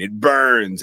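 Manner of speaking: loud, drawn-out mock cry; retold pained scream. Retelling Reagan hit by holy water, mock screaming. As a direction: stretch it out like a mild scream. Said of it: "She screams in pain".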